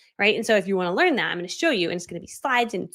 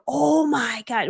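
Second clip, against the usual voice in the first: slow, high mock amazement; imitated starstruck kids; 5 semitones higher, much slower than usual. Kids see her follower count, imitated amazement. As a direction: stretch it out like an awed fan.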